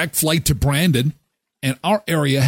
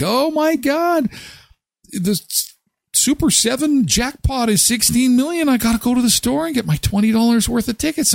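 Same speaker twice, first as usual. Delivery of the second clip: excited relative; 13 semitones higher than usual. Retold or imitated. Mocking family members rushing to buy tickets, higher excited voice. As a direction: imitate a relative gushing about the jackpot. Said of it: "family members who are like".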